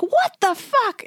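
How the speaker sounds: high, drawn-out mock shriek of disbelief; retold shock. Runner standing there after kicking a bird, high shocked cry. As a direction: stretch it out slowly, way up in pitch.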